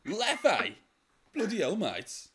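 high, loud disgusted bloke; hammed-up outrage at the beer; aussie tradesman. Mock disgust at the Leffe guess.

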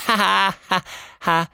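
high, loud forced ha ha ha; each ha punched out high and loud; kid laughing at own joke. Acted.

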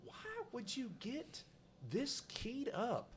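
high, very slow disbelieving question; puzzled man wondering why the stranger's so angry; incredulous. Retold or imitated. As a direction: stretch it out in disbelief. Incredulous inner reaction.

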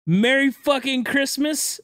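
high, slow, bitter mock cheer; sarcastic. Real, not acted. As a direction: drag out the fake festive greeting. Sarcastic holiday greeting after accident.

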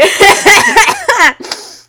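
high, loud cackle of glee; loud triumphant laughter; loud gleeful laugh. Real, not acted. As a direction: burst out laughing, delighted. Laughing at the exposure.

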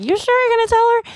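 very high-pitched incredulous question; high, strained mock disbelief; doubtful aside. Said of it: "I look at him like".

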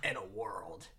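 low whispered movie trailer narrator; trailer voiceover. Shown as a demonstration. Mock trailer voiceover for the audience, low and breathy. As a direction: open the trailer dramatically. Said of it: "a voiceover for a movie trailer".

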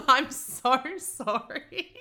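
slow laughing apology; sorry squeezed out through giggles. Real, not acted. Laughing as she apologises to her old date.

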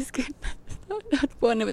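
high, soft, choked-up mock crying; llanto imitado. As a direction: break off as if too tearful to talk. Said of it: "yo estaba llorando de que". (imitando no poder hablar por el llanto)

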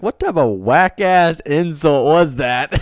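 loud, high, slow mock outrage; incredulous retort. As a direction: re-enact the retort at full volume. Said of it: "then I was like".

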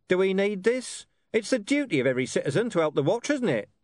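high-pitched, brisk commander; cajoling police commander. Acted. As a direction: talk the witness round, briskly.

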